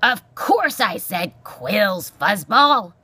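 loud, slow exasperation; irascible porcupine. Acted. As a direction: hammer it out impatiently. Porcupine snaps at the mouse.

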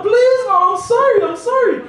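very high, loud frantic begging; boy pleading as mum chases him; panicked pleading. Retold or imitated. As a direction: beg for mercy while running away. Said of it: "And I was like".

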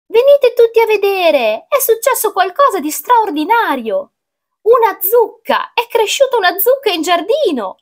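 high-pitched, astonished calling out; excited mother. A narrator's reading. Mother summoning the family to the pumpkin, high excited call. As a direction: call everyone over in a high, amazed voice. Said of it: "dalla voce della mamma".

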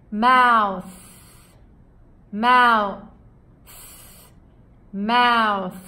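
th sound demo, tongue between teeth; TH drill. Shown as a demonstration. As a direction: Mouth with breath through the teeth gap at the end. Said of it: "まずは口、マウスですね。".

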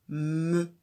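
"me?" said loud and distinct; teacher quizzing with a loud "me?"; quiz syllable. Shown as a demonstration. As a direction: call out "me?" clearly for the child to find. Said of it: "où est la syllabe".

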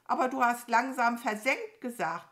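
hohe, laute Krähe; rechthaberische Krähe. Acted. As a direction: vorwurfsvoll auf dem Fehler herumreiten. Krähe hält falsches Wort vor. (rechthaberisch, hoch und laut)

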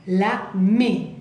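usual speaking voice

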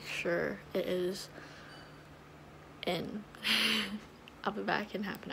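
talking with strips on; whitening strips on teeth. Real, not acted. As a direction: finger pressing on teeth. Seen 3: Finger presses clear strips onto teeth; gone by +10.1 later clip.

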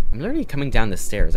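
indignant fast protest after being bumped on the stairs. Acted. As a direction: snap back that you were just walking down.

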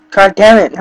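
angry outburst. Real, not acted. Frustrated gamer swearing.

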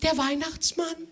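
put-on small, very high child voice, a little scared; a timid boy answering Santa; a bit frightened. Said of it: "sagte Thomas etwas ängstlich".

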